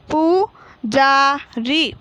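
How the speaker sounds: slow, long पू, जा, री; teacher stretching each syllable of पुजारी. Shown as a demonstration. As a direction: sound out पू जा री as separate pieces. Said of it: "तो हम इस प्रकार पढ़ेंगे".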